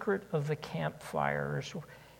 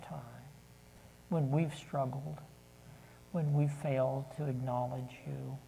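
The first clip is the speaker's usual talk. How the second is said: prayer. Real, not acted. Leads closing prayer.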